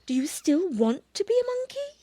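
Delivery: high, soft mother cat voice; gentle mother cat. Acted. Mother bush cat checking with her baby, gentle.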